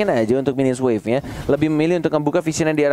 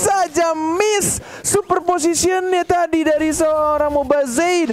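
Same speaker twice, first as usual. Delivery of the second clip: excited; 10 semitones higher, slower than usual. Real, not acted. Caster hyping near miss.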